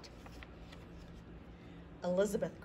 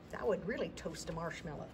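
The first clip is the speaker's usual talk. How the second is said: usual speaking voice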